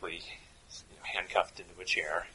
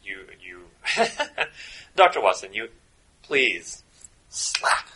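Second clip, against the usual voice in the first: bad German accent; 3 semitones higher, 8 dB louder than usual. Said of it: "doing a very bad German accent".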